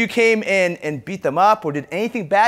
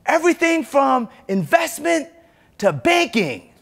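comedic speaker; 8 semitones higher than usual. Retold or imitated. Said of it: "he said something like".

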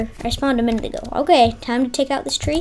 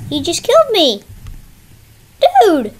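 higher, louder cry of disbelief; indignant outburst; 5 semitones higher, 5 dB louder than usual. Real, not acted. Player complains about being killed in the game, indignant. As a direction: protest loudly at being taken out.

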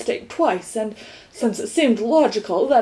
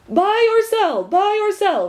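higher, louder impatient repeat; 10 semitones higher, 3 dB louder than usual. Acted. Gateman pressing Milo to answer, impatient. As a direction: hurry them along, impatient. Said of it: "repeated the gateman impatiently".

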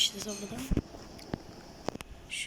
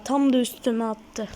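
louder annoyed repeat; 9 dB louder than usual. Real, not acted.

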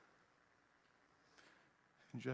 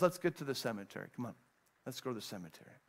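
hushed urging; 6 semitones lower, 11 dB quieter, much faster than usual. Acted. Jesus urging Mary on.

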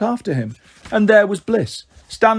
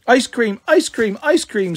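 put-on high, gleeful child's chant; happy little child; 6 semitones higher, slower than usual. A narrator's reading. Pixie delighted at promised ice cream, high happy voice. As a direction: chirp it happily in a small child's voice. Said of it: "said Pixie happily".

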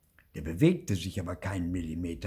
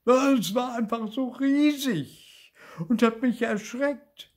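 loud, high, slow wail; distressed little ghost, pitched very high; frightened stammer; 7 dB louder than usual. A narrator's reading. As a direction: blurt it out in fright. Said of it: "stammelte Bibi".

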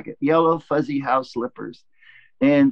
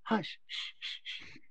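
whispered hush, pleading to be quiet; urgent shushing whisper; 7 semitones higher, 17 dB quieter, slower than usual. Retold or imitated. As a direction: shush the laughing wife in a whisper. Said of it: "And I'm like".

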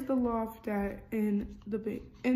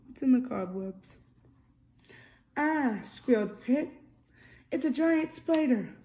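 lively storybook reading, character lines a bit louder; reading to children; 2 semitones higher, 6 dB louder than usual. A narrator's reading. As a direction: read it like a bedtime picture book. Reading picture book aloud.

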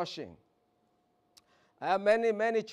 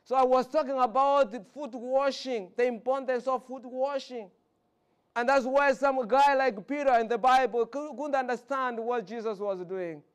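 higher, louder sermon voice; preaching; 6 semitones higher, 7 dB louder than usual. Acted. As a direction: teach the church with full force. Sermon on foot washing.

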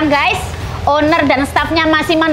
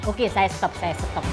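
hushed; 5 semitones lower, 9 dB quieter, slower than usual. Real, not acted. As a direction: drop to a soft hush. Stops as owner watches.

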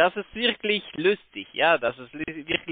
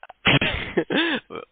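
laugh; 8 semitones higher than usual. Real, not acted. Scammer laughs off accusation.

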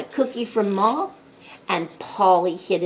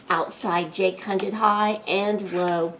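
usual speaking voice

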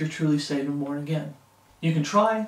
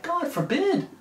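"God forbid" jumps much higher, a mild indignant cry; Paul's "God forbid" lightly voiced, pitched much higher; Paul's indignant retort; 11 semitones higher, slower than usual. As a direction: fling "God forbid" up high in protest. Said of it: "Paul's like".

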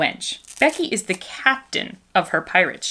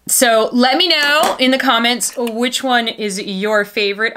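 normal talking voice

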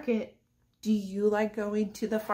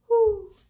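high, loud owl hoot; 13 semitones higher, 9 dB louder than usual. Acted. Teacher hoots like an owl for the kids.

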